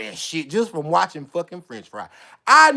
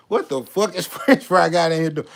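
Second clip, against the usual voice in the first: usual speaking voice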